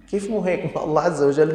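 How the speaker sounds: sharp high rebuttal; indignant disbelief. Real, not acted. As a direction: throw the excuse back in disbelief. Preacher retorts in disbelief. (استنكار حاد بصوت عال)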